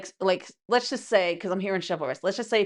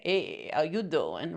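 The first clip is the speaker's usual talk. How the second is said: slow, low-pitched pickup line; casual come-on; 4 semitones lower, 4 dB quieter, slower than usual. Acted. Mimicking an admirer who can't just approach casually, slow low come-on. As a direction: slide in with a drawn-out, low greeting.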